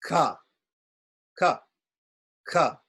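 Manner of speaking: "ka" repeated, plain unaspirated K; ka syllable. Shown as a demonstration. Teacher drilling ka for students. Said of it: "So it's".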